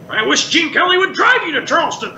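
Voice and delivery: put-on cranky old man landing the punchline; Waldorf. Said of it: "And then I would say".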